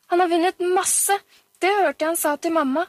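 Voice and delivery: put-on high, loud little-girl protest; insistent boasting child. A narrator's reading. Pia-Pia overrules Oliver's correction, insistent boasting child.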